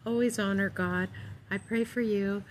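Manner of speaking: usual speaking voice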